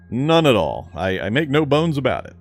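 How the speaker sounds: ordinary talk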